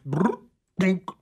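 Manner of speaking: vocal rumble, then a dull thud; imitated collapse noise. Host telling of a man dropping dead mid-landscaping, imitated collapse noise. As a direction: make the sound of someone suddenly dropping. Acting out sudden collapse in the yard.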